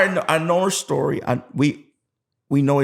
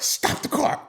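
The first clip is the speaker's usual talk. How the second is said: whispered mock panic; acted frantic whisper; urgent hush; 5 dB quieter, slower than usual. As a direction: hiss it to friends while covering the phone. Hushing friends during the call.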